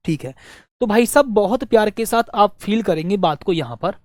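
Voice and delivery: normal talking voice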